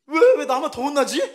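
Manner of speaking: put-on child's protest.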